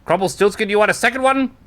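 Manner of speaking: arena announcer calls for the next fighter, nervous. Acted. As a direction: hype the crowd over jittery nerves. Announcer addresses Crumble Stiltskin.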